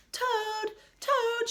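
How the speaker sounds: high calls of Toad's name; calling out. Acted. Frog calling at the door for Toad, high calling voice. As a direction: call out high for a friend. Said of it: "shouted Frog".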